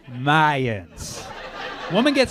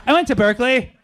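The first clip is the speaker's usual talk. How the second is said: boastful entrance; 7 semitones higher, 5 dB louder than usual. Retold or imitated. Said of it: "It's like".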